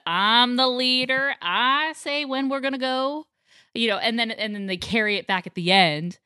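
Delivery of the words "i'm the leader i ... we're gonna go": loud, slow drawn-out declaration; higher, emphatic cartoon boss voice; imitating the bossy leader. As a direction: play the self-appointed leader, stretching each phrase. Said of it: "I just love how he has the".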